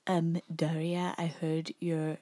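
lightly voiced, soft cartoon character; Sandy. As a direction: quote her softly, a slight put-on voice. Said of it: "Sandy approaches the conversation by saying".

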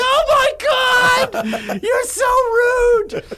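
shrill, loud, stretched-out outcry; outraged old lady. Retold or imitated. Old lady shocked by his insult, loud high outcry. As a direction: wail it like a scandalized old woman.

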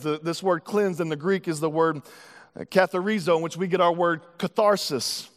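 ordinary talk

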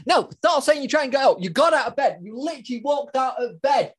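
laughing self-scolding. Pretend. Mock scolding himself, laughing.

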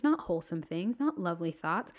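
ordinary delivery

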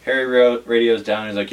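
ordinary talk, nothing marked

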